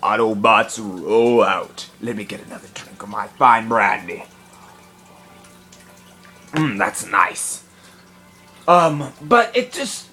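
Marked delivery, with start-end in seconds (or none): 0.0-7.6 much lower, slow put-on robot leader voice; deep Optimus Prime voice. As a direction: growl it out slow like a giant robot commander. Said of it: "it's hard to talk like this".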